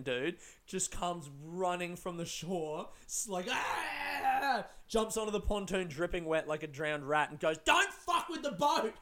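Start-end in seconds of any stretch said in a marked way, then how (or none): 3.5-4.6 high-pitched charging scream; mock battle yell. Man running at them from shore, imitated high yell. 7.6-8.9 high, slow strained shout; hammed-up crazed warning, far higher than usual; mock furious yell. Dripping man jumps on pontoon, mock enraged high yell. Wet stranger confronts boat thieves.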